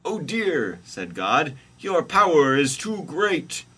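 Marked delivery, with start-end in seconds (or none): 0.0-0.8 high, loud "oh dear"; hammed-up storybook alarm; comic dismay. God's dismay, read aloud. 1.8-3.6 high, loud proclamation; hammed-up storybook deity; comic God voice. God's quoted line in story.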